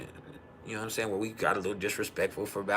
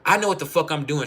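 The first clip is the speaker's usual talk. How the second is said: higher, louder mock protest; retold defiant insistence; 6 semitones higher, 5 dB louder than usual. Wife insisting she can fix the starter, defiant.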